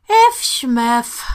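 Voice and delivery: put-on slurred drunken mumble. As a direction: slur it like she's tipsy. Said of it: "swaying a little herself".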